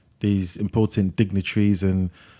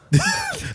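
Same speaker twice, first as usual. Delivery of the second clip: short squeaky laugh; a quick high giggle. Real, not acted. Laughs after a verbal slip.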